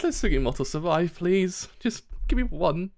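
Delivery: high, urgent begging; pleading. Real, not acted. Streamer begging that Sugimoto survives, pleading. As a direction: plead for just one favour.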